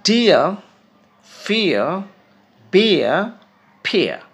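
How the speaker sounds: each word said loud and apart; teacher's word list; word list drill. Shown as a demonstration. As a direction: drill ডিয়ার, ফিয়ার, বিয়ার. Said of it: "উচ্চারণগুলো খেয়াল করো".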